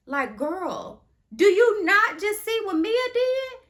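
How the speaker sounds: high, loud "do you not just see"; exasperated outburst. Real, not acted. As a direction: call her out, loud and high.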